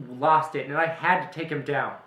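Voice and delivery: angry. Acted. Detective snaps back at widow.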